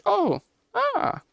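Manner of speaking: sudden high startled gasp. Real, not acted. Finding a stranger asleep, a high startled gasp. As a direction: caught off guard, pitch jumps up.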